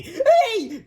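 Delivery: very high, loud cheer; children's cheer. A narrator's reading. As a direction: cry out like a classroom of kids. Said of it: "cried the children".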